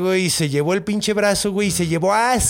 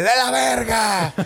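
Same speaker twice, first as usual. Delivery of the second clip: higher, slow gruff grumble; grumpy king; 6 semitones higher, slower than usual. Retold or imitated. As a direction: growl out a miserable answer.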